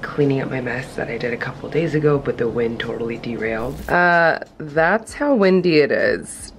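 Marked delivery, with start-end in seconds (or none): 0.0-3.9 low, hushed and slowed; soft, low voice so nobody wakes; talking quietly. Real, not acted. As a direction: keep it down for a sleeper next room. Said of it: "I'm talking quiet".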